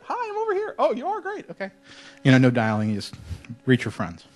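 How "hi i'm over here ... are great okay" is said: high-pitched mock phone voices; quick little pretend call, pitched way up; hammed-up push-to-talk exchange; acted walkie-talkie chat.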